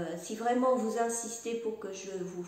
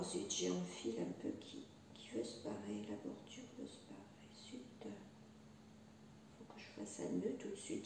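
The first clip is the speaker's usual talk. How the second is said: muttering; 3 semitones lower, 14 dB quieter than usual. Real, not acted. Mutters to herself over loose thread.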